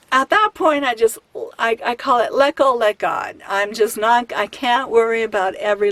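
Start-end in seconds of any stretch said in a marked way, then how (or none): none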